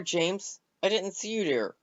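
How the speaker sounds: plain reading voice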